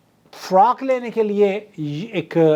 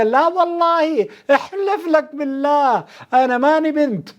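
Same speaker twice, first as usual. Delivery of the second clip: high, drawn-out oath of denial; Yemeni shopkeeper protesting; 8 semitones higher, slower than usual. Retold or imitated. Yemeni shopkeeper swearing to a customer he is no girl, mock protest. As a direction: imitate an indignant shopkeeper, pitched well up. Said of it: "یہ جو یمنی دکاندار تھا وہ کہنے لگا".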